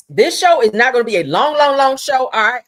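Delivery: loud, emphatic announcement. Real, not acted. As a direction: belt it out to the chat.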